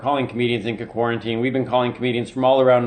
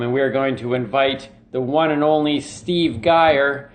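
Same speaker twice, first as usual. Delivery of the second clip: ordinary talk, nothing marked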